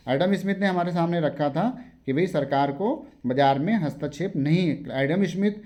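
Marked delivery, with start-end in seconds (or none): none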